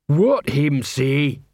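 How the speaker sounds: very slow, puzzled troll voice; plodding troll character. A narrator's reading. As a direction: ask it slowly as a simple troll. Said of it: "Since he was a troll".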